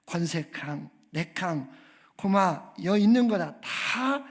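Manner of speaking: 경상도 사투리로 투박하게 외치는 주기도문; Gyeongsang dialect. Pretend. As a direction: pray like a blunt Gyeongsang man for laughs. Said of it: "그런데 이제 경상도 버전".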